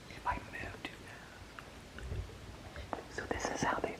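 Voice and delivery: real whisper so the dogs stay asleep; hushed, barely a breath. Real, not acted. Filming the sleeping dogs up close.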